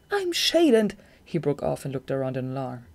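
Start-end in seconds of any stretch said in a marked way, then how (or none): 0.1-0.9 high, loud, breaks off sharply; startled. A narrator's reading. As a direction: Shade introducing himself then breaking off in alarm. Shade cut off in alarm.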